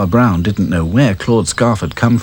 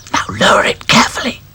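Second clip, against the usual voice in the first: breathy whisper; 13 semitones higher than usual. Acted. Dotty instructing during rescue, whisper. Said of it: "whispered Dotty".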